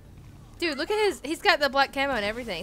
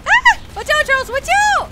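high loud cry of watch out; alarmed warning shout; 11 dB louder than usual. Real, not acted. Warning teammate in game.

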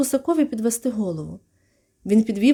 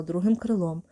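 plain reading voice

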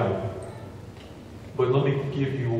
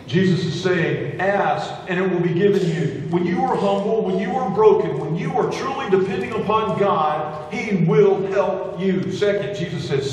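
full-force preaching; 6 semitones higher than usual. Acted. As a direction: preach it hard to the congregation. Pastor builds to full preaching.

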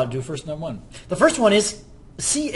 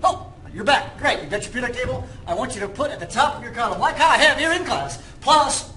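higher pitched hard-sell pitch; slick used car salesman; 6 semitones higher than usual. Pretend. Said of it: "Do I sound like a car salesman?".

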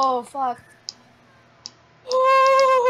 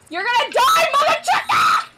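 screaming threat; 4 semitones higher than usual. Real, not acted. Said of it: "do you have to scream".

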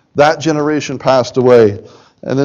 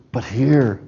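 quieter take; 3 semitones lower, 7 dB quieter than usual. Shown as a demonstration. Contrasting not here with here.

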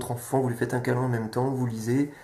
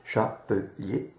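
syllabic decoding; 3 semitones lower, much slower than usual. Shown as a demonstration. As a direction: spell out Chapelier slowly like a child decoding. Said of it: "En syllabique, on peut tout lire". (Chapelier lu lentement, syllabe par syllabe)

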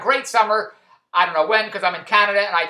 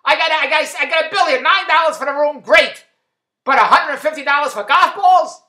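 loud, higher outraged complaint; indignant outburst; 5 semitones higher, 6 dB louder than usual. Retold or imitated. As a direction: demand an explanation at the desk. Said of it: "I said".

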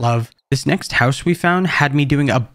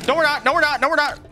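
panicked; 13 semitones higher, faster than usual. Real, not acted. Panic again.

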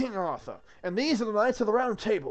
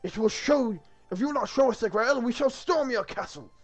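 high, loud acted warning; kingly threat; 7 semitones higher, 7 dB louder than usual. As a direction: threaten the castle as an indignant king. Arthur threatening the guard.

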